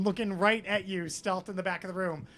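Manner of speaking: ordinary talk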